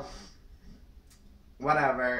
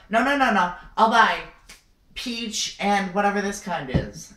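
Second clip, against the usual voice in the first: loud, high-pitched acted pleading; urgent protest; 5 semitones higher, 7 dB louder than usual. Customer bargaining as security is called, loud protest. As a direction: protest loudly to stop them.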